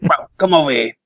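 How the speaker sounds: imitating a mobster calling a kid over; lightly voiced Brooklyn wiseguy; Brooklyn mafia guy. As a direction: beckon like a neighbourhood mobster. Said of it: "all those mafia guys".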